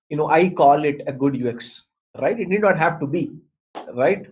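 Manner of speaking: ordinary talk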